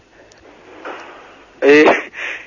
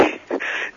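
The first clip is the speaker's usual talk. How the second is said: a burst of silent, breathy laughter held back; trying not to crack up on the phone; stifled breathy laugh; 13 dB quieter than usual. Real, not acted. Postal clerk laughing despite himself at the caller, stifled breathy laugh. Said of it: "Qu'est-ce qui vous fait rire?".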